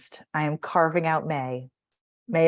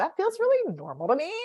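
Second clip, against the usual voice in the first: higher, floating up like a question; high upspeak; 10 semitones higher than usual. Retold or imitated. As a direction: pretend it feels normal. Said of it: "you realize".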